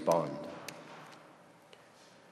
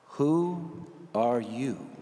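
slow searching question; much slower than usual. Shown as a demonstration. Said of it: "Define yourself".

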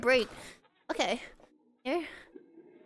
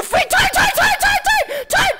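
frantic shout; 13 semitones higher, 7 dB louder than usual. Real, not acted. Frantic shouting while crashing.

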